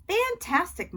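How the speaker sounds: high, loud emotional exclamation; overwhelmed proud mom; overcome with emotion. A narrator's reading. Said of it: "so emotional she could barely talk".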